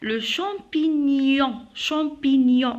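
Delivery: slow "champignon" with gn as ny; careful slow model of "champignon"; correct gn, drawn out. Shown as a demonstration. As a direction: stretch "champignon", gliding into -nyon. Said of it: "on dit champignon".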